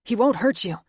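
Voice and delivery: loud, high plea to the soldiers; urgent shout. Acted. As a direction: yell it to stop them shooting. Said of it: "Luke cried".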